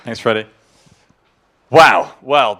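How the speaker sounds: usual speaking voice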